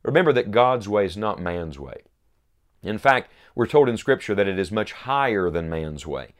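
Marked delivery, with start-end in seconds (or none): none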